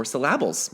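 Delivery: "syllables" jokingly stressed as sil-LAB-uls; wrong-syllable stress. Pretend. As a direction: hit the middle of "syllables" on purpose. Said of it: "emphasize the right part of the syllable".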